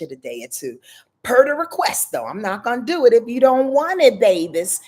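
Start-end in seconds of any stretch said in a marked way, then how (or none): none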